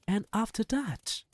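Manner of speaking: put-on high, eager boy's question; quivering with excitement. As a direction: ask breathlessly eager. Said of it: "quivering with excitement".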